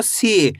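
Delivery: सी with a long, held ee; drawn-out vowel. Shown as a demonstration. Teacher demonstrating the big matra, सी stretched out. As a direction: stretch the ee of सी slowly. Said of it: "बड़ी ई की मात्रा को खींच".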